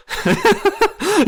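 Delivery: squealing high giggle; a giggle bursts out shrill. A narrator's reading. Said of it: "A high giggle burst out".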